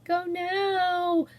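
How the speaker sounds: high, frightened trembling plea. Acted. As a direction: let the voice tremble with fear. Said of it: "her voice trembled a little".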